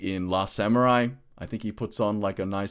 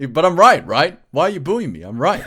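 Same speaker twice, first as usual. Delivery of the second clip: higher, louder, laughing defiance; loud insistent protest; 8 semitones higher, 11 dB louder than usual. Real, not acted. S0 to booing cohost, loud insistent protest. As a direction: insist you're right over the booing.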